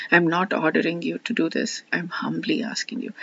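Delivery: normal talking voice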